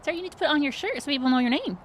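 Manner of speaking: high, pushy sugary pleading; insistent perky HR lady. Acted. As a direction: chase him down sweetly but firmly. Becky insists player wear tag.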